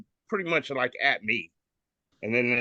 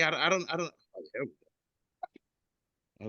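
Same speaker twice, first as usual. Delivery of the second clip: ordinary talk, nothing marked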